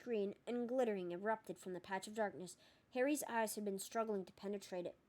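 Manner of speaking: ordinary delivery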